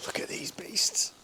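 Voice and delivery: whispered awe. Real, not acted. Admiring bike, whispered.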